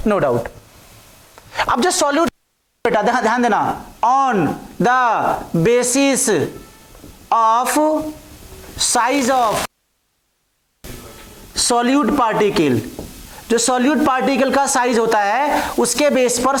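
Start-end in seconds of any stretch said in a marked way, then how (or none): 4.0-12.8 one word at a time, long pauses; slow dictation. Real, not acted. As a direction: dictate slowly while writing. (एक-एक शब्द रुककर लिखवाते हुए)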